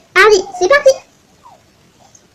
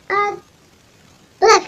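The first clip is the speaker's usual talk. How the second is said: ordinary talk, nothing marked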